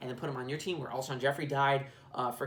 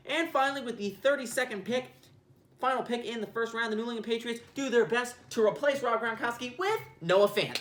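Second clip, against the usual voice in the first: mock commissioner announcing the selection; draft announcer; 7 semitones higher, 3 dB louder than usual. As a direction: announce the pick like it's draft night.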